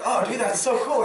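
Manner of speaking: high, loud mock excitement; mock excited. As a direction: gush like an eager teammate. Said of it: "And you'll be like".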